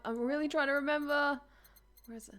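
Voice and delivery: normal talking voice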